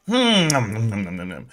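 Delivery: falling "nom nom" chomps; mock munching noises. Streamer pretending to eat grub, mock munching.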